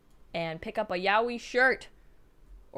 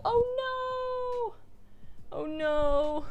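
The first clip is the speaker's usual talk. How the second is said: long, high "oh no" wail; drawn-out little cry of dismay; high-pitched dismay; much slower than usual. Real, not acted. Dismay over ruined sticky notes.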